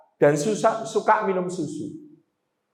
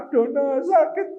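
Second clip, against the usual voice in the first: mock old parent groan; 7 semitones higher than usual. Old parent complaining of pain.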